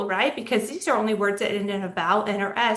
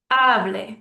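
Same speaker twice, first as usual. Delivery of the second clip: loud HA in hable, light -ble; stress on second-to-last syllable; 5 dB louder than usual. Shown as a demonstration. Teacher repeating the example, hable stressed on HA. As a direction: punch the first syllable of hable. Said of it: "that's the second to last syllable".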